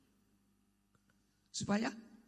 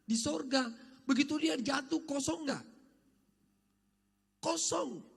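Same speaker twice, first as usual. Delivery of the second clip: preaching; 8 semitones higher than usual. Acted. Preaching at full force.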